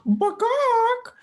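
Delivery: loud, high bird squawk; imitated poultry cry; turkey squawk. Friend plays a turkey.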